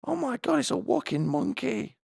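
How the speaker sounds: put-on very high squealing children; kids' voices. Schoolchildren at the gate, very high squealing. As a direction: shriek like startled little kids. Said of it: "they run to the gate and they go".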